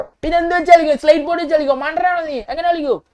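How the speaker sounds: high, loud indignant questions; exasperated scolding. Acted. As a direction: scold your friend for being foolish. Scolding Michael as a fool. (ദേഷ്യത്തോടെ ശകാരം)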